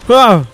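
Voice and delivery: loud, high yelp; short burst of alarm; startled shout. Real, not acted. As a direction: cry out sharply at a hit. Hit in game.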